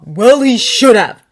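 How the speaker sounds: slow, loud snap; Harry snapping at Hermione, slow and loud; snapped. Acted. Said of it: "Harry snapped".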